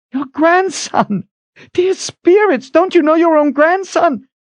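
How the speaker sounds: loud, high exasperated plea; frantic. A narrator's reading. Kalen pressing Zed about his grandson, frantic. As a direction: cry out in frantic disbelief. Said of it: "Kalen was frantic".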